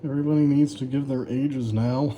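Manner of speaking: ordinary talk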